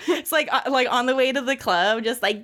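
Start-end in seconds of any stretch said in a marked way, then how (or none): none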